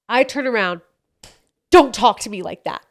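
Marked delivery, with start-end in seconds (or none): 1.7-2.8 acted-out scolding in a changed voice; reenacted angry rebuke. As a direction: reenact telling off your husband while mad. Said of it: "let me reenact".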